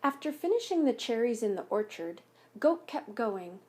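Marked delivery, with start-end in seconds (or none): none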